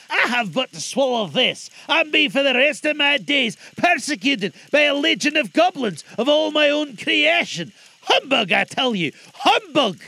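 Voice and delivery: put-on high, loud, blustering old miser; scoffing Scrooge.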